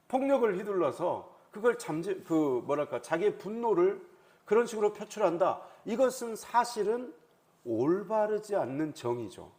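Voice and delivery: normal talking voice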